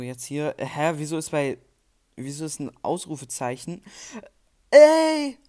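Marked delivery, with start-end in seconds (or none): 4.7-5.4 shout. Real, not acted. Shout at game mishap.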